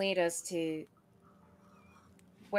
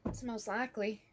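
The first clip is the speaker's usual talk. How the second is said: put-on country accent; 2 semitones higher, 6 dB quieter than usual. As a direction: lay on a rural country twang. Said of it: "I don't know if I like that country accent".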